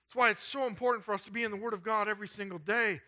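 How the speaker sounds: high, emphatic sermon exhortation. Real, not acted.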